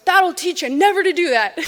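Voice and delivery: mock scolding. Pete scolding after the shot.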